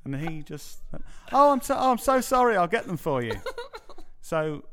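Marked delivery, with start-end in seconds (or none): 1.3-3.3 high, loud, gushing mock apology; flustered apology. Bar manager apologising to a customer over missing drinks, flustered. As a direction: imitate a manager falling over himself to apologise.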